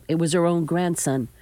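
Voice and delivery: ordinary delivery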